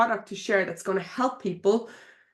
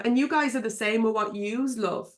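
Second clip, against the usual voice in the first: ordinary delivery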